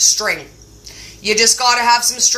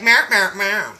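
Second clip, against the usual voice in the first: imitated cat back-talk meows; sassy meow; 3 semitones lower than usual. Cat talks back to owner, sassy meowing. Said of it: "he gets very sassy about it".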